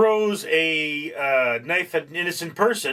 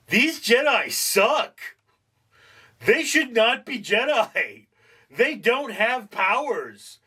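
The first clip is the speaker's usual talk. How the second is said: higher, slow, fed-up verdict; slow disgusted complaint; 7 semitones higher, slower than usual. Retold or imitated. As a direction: drag it out in disbelief. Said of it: "you're like".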